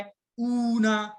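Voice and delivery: اونا stretched very slowly, long vowels held; drawn-out plural ending. Shown as a demonstration. Teacher models the plural ending sound slowly for students. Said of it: "اینڈ میں sound آئے".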